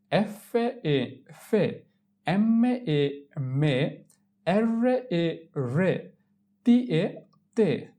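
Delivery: each spelling like "এম এ এ" drawn out slowly, then blended "মে"; syllable drill. Shown as a demonstration. Teacher drills learners on consonants with এ, measured spelling-out. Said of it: "এ এর উচ্চারণ হবে ইয়েকার".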